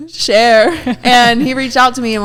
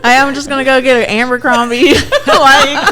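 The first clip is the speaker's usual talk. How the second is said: laughing through a Southern twang; hammed-up Texan accent through laughter; 5 semitones higher, 5 dB louder than usual. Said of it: "your damn accents".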